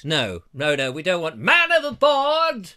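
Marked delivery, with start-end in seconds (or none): 1.4-2.7 higher, louder mock alarm cry; hammy panicked shout, slowing at the end; man overboard shout.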